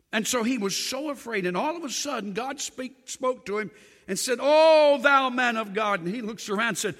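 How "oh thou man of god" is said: loud booming call from heaven; a loud divine summons; calling out as God. Acted. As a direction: call out to him with authority.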